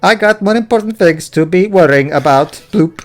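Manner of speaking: robot chef with Welsh lilt; sing-song Welsh robot; Welsh accent. Acted. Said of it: "when did you become Welsh".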